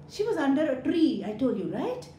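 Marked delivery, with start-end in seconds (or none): none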